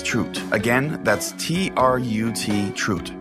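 normal talking voice

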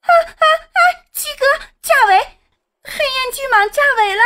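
very high-pitched, piercing cries of alarm; sharp female cry. A narrator's reading. Sister cries out to her brother as the python bursts, shrieking. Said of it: "女子尖锐的叫声".

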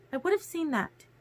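high voice, soft and insistent; insistent Alice. A narrator's reading. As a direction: insist quietly, pitched high. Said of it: "Alice insisted".

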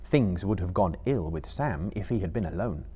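ordinary delivery

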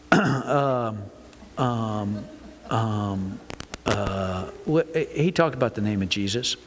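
low, drawn-out ums, hunting for an answer; stumped hesitation. Acted. Churchgoer asked what the preacher talked about, stumped. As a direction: play someone groping to remember, pitched low. Acted churchgoer struggling to recall sermon.

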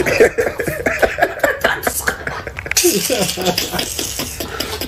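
high, airy laughing fit; real helpless wheeze; long wheezing laugh. Real, not acted. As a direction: laugh till the breath runs out. Cracking up over his story.

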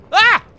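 short, loud, high yelp; sudden high scream of shock; startled scream. Real, not acted. Startled by sudden game danger.

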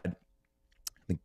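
ordinary talk

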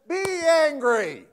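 loud, slow, high command "Be angry"; booming command. Acted. Preacher to congregation, booming scripture command. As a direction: proclaim it like a stern order.

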